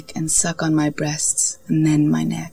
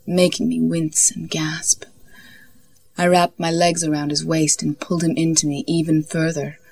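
plain reading voice

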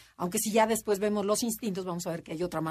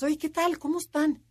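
high, bright mock hello; cheery greeting; 8 semitones higher than usual. Friend cheerfully greets an eight in crisis, pitched high.

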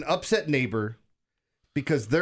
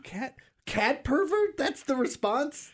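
higher, slow, disbelieving repeat; incredulous; 11 semitones higher, slower than usual. Real, not acted. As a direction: savor the absurdity slowly.

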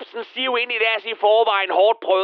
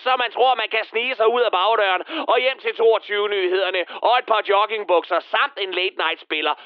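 ordinary delivery

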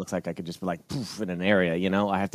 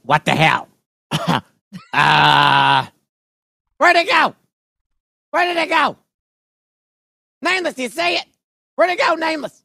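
frantic shout; 8 dB louder than usual. Acted.

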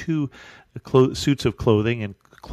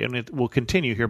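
ordinary talk, nothing marked

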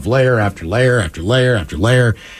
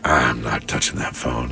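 very low, quiet, slow; low wary refusal; low paranoid wife; 13 semitones lower, 7 dB quieter than usual. Retold or imitated. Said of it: "she's like".

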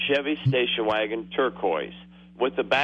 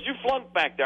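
mock examiner; 9 semitones higher than usual. Caller voicing the examiner.

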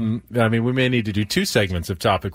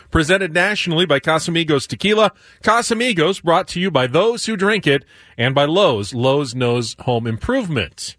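brisk sponsor read, a touch higher; 5 semitones higher, 3 dB louder than usual. Acted. Host reads the sponsor tag, brisk ad voice.

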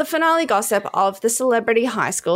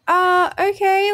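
stunned okay; 11 semitones higher, 4 dB louder, much slower than usual. Retold or imitated. Reacting to the pissing child.

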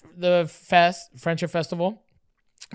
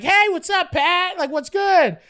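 high, louder laid-back hey; cool greeting; 10 semitones higher, 5 dB louder, slower than usual. Retold or imitated. Teen calling Trish, smooth casual greeting. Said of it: "I'm like".

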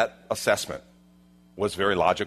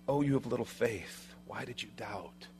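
murmured, acted disappointment; hushed gentle rebuke; 12 dB quieter than usual. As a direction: chide him quietly, almost under the breath. Jesus gently rebukes sinking Peter.

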